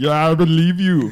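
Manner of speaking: high, loud sarcastic mock belief. Mocking the Kentucky claim, loud high sarcastic voice. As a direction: say it loud and clearly not believing.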